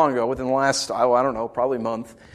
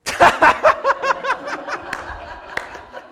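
high, long burst of laughter; real laughter. Real, not acted. Preacher reacting to Stan's crosswalk joke, real laughter. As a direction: crack up helplessly.

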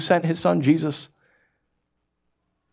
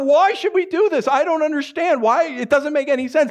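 high, raised protest of bafflement; mock screaming; 10 semitones higher than usual. Congregation told to love enemies, mock screamed protest. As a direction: cry out as a congregation that can't accept it. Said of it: "we're probably screaming now".

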